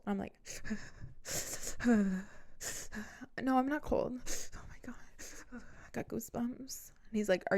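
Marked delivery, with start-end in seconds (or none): 0.4-3.4 exaggerated shivering breaths; pretend freezing shiver. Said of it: "pretending like I'm freezing". 3.4-4.1 high-pitched mock denial; feigned denial. Feigned denial while pretending to freeze. 4.5-7.6 pretend freezing. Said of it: "pretending like I'm freezing".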